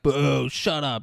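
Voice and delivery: booing crowd. Retold or imitated. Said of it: "the crowd's booing him".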